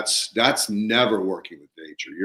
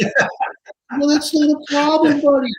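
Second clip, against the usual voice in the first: much higher, louder, gleeful; delighted; 7 dB louder than usual. Retold or imitated. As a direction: laugh off his worry, beaming. Delighted reply about earthworms.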